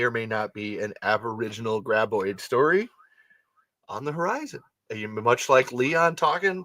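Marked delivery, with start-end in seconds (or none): none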